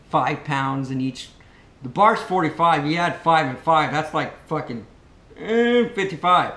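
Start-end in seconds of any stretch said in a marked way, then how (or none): 5.4-5.9 high, loud lifting grunt; mock strain grunt. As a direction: grunt as if heaving a barbell. Acting out straining under the bar.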